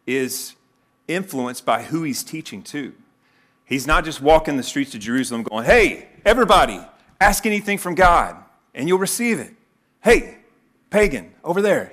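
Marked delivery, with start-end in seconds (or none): 5.6-11.9 loud, high street-corner holler; mock shouting to a crowd; calling out. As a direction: hail passers-by across the street. Acted street call to passers-by.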